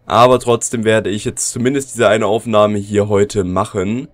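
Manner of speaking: usual speaking voice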